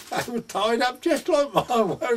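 high, loud, drawn-out protest; astonished protest. Retold or imitated. Friend protesting over his misjudged shallots, astonished. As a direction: act out his astonishment, pitched right up. Friend flustered as penny drops.

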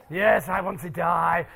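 slow, low put-on voice; mock volunteer. Performer voicing a volunteer to be poisoned, slow low mock eagerness.

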